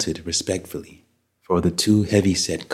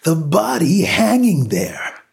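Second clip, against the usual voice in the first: high-pitched, stretched-out frantic shout; desperate cry to make them see; 11 semitones higher than usual. A narrator's reading. Panicked man pointing neighbors to the hanging body, shouting. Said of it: "Ed shouted".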